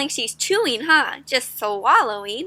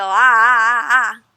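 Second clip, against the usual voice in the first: louder slurping-gulp sounds; mock gulping; 6 dB louder than usual. Teacher mimicking bad table manners, slurping noises. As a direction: gulp like eating straight from the bowl. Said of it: "lift the bowl and go".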